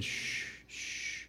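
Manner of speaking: soft unvoiced shh, shh to calm them; gentle shushing. Jesus hushing his weeping disciples, whispered shushing. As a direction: hush them like frightened children.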